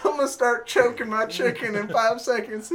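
loud, high, hammed-up urgency; imitating a man at breaking point; mock desperate warning. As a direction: blurt it out as a comic confession. Imitating the tormented congregation member.

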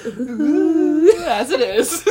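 high spooky ooh breaking into laughter; giggling through a ghost moan; laughing spooky voice. Pretend. Laughing spooky intro attempt.

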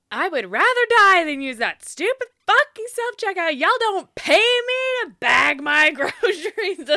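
loud high mock outrage; dramatic rant. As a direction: rant it, laughing at the end. Hammed-up rant.

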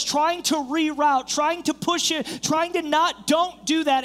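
high-pitched, driving sermon delivery; forceful preaching. Acted. Preacher warning the congregation through Jehoshaphat's choices, forceful and insistent. Preacher builds to closing point.

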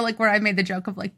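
ordinary delivery

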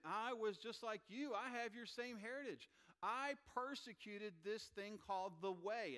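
high, loud, emphatic testimony; impassioned Paul. Acted. Paul to the crowd that accused him, impassioned appeal. Acting out Paul's plea to crowd.

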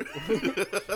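short loud laugh; quick loud chuckle; laughing burst. Real, not acted. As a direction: crack up.

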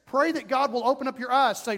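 ordinary talk

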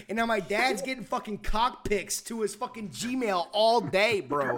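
ordinary delivery

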